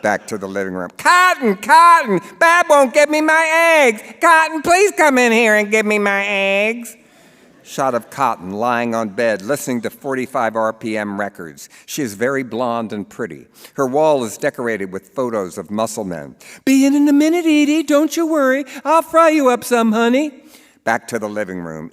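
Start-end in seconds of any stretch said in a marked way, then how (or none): 1.0-6.9 high, loud, whining demand for eggs; whiny old mother. Acted. Edie calls Cotton for eggs. 16.6-20.4 high, loud sweet reply; companion calling back. Acted. Cotton answers Edie.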